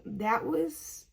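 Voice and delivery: usual speaking voice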